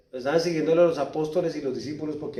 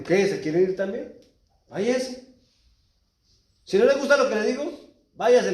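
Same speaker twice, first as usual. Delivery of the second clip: high, loud stern dismissal; Jesus telling disciples to leave, loud; Jesus rebuking; 8 semitones higher, 3 dB louder than usual. Retold or imitated. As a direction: send them away with force. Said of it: "Así le dijo".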